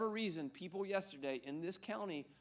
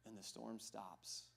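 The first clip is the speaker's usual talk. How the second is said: ordinary delivery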